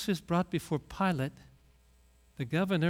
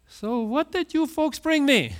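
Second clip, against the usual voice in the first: mock greedy ask; 8 semitones higher, 4 dB louder than usual. Jesus imagined asking crowds for gifts.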